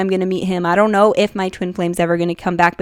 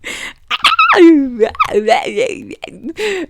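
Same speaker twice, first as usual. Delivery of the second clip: excited squealing laugh; 14 semitones higher than usual. Real, not acted. Overwhelmed with excitement over message.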